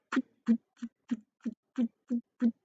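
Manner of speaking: soft mechanical putting sound; machine putt-putt. Acted. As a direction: imitate a small chugging machine.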